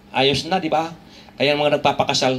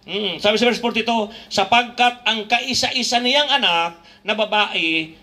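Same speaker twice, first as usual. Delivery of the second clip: slow, raised scripture reading; preaching; 6 semitones higher, slower than usual. Acted. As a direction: read the verse slowly with weight.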